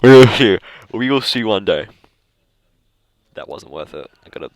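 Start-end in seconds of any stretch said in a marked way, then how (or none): 0.0-1.9 loud, drawn-out joke delivery; mock outburst. Vlogger jokes about maybe being a librarian someday, mock outburst. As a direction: belt it out slowly for comic effect.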